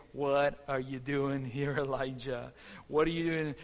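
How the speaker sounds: a hushed, searching divine question; God's gentle voice. Acted. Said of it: "And a voice said".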